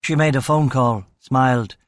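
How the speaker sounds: plain reading voice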